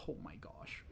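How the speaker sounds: breathy whispered oh my gosh; whispered dismay. Real, not acted. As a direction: sigh it out under your breath. Dismay at failing search.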